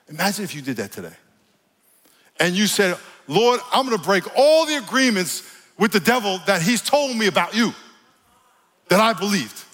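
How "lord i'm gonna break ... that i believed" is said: high, emphatic declaration to God; fervent prayer. Shown as a demonstration. Preacher breaking agreements with the devil, fervent high prayer. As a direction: pray it out with rising force.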